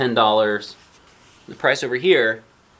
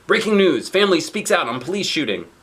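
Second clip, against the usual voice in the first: news anchor read; 7 semitones higher, 4 dB louder than usual. Retold or imitated. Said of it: "says the T V".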